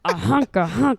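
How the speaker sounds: Cookie Monster voice. Pretend. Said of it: "Cookie Monster voice".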